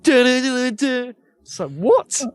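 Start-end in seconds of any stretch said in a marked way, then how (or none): 0.0-1.1 high mocking duh duh console noise; mimicking console sounds. Mocking the Halo four console startup sound to friends, high and sneering. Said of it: "Halo four is just like".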